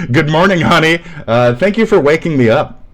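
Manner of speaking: fake-sweet morning hello; sarcastic sweetness. Thanking a commenter with mock sweetness.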